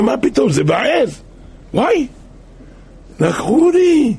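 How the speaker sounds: high, startled cry of loss; shocked dismay. Acted. Goat owner finds his goat stolen, crying out in shock. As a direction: gasp out the theft as you discover it.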